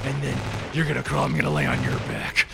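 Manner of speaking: Batman voice. Acted. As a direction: growl it low and gravelly like Batman. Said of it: "we still sound like Batman".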